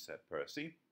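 plain reading voice

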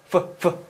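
isolated F bursts on 뿌, 뿌; F sound demo. Shown as a demonstration. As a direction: bite the lip lightly and puff 뿌. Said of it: "지그시 깨물어 줘야".

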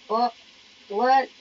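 ordinary talk

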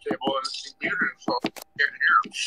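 quick hushed whisper. Real, not acted. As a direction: whisper it fast under breath. Whispering a repeat.

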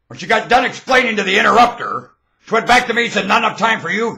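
loud, high indignant recounting; heated retelling. Retold or imitated.

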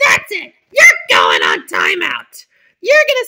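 teacher yelling a timeout; angry scolding. Pretend. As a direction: lay down the punishment at full volume. Teacher punishes Little Bill.

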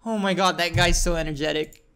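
ordinary talk

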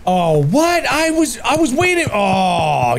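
very high-pitched indignant squeal; outraged protest. Real, not acted. Player caught while waiting in the game, outraged. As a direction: cry foul in a shooting-up voice.

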